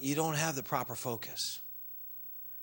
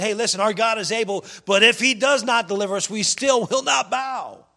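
defiant declaration; 7 semitones higher, 10 dB louder than usual. Acted. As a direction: stand up to a king, louder each phrase. Acts out defiant men before king.

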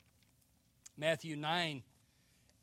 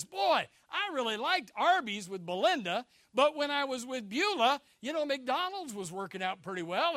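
mock-enthusiastic young waverer, pitched higher and louder; wavering suitor; 6 semitones higher, 6 dB louder than usual. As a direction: play up a suitor torn between options. Waverer comparing dates and restaurants.

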